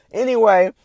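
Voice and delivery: sudden loud high "Anyway" snapping back on topic. Real, not acted. After the beer aside, back to his rant, a loud high "Anyway".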